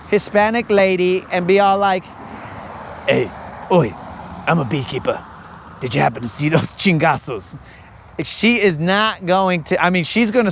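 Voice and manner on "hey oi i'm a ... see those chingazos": low, soft English tough guy; English accent. Acted. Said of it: "You're butchering the English accent".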